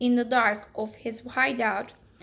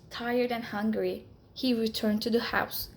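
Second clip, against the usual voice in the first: ordinary delivery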